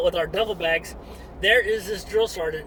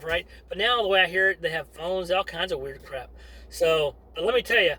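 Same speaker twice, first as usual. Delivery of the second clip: usual speaking voice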